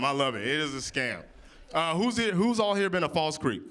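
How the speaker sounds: ordinary talk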